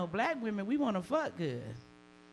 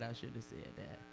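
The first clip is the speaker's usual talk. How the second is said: much softer, half to herself; muttered regret; 8 semitones lower, 12 dB quieter than usual. Real, not acted. Comic caught after a bomb joke, muttering softer.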